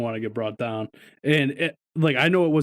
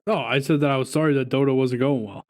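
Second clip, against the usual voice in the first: ordinary delivery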